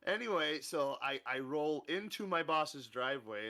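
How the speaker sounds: ordinary talk, nothing marked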